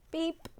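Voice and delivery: short high beep; light, high horn toot; little truck's beep. A narrator's reading. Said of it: "said Blue".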